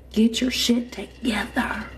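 slow unvoiced whisper. Real, not acted. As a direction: hiss it under breath. Whispered aside to viewers.

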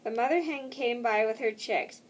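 plain reading voice

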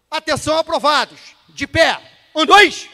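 loud, high parade-ground call; shouted drill command. Shown as a demonstration. Instructor orders the approved candidates to their feet, shouted drill command. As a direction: bellow orders to a whole platoon. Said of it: "Aí eu vou falar assim".

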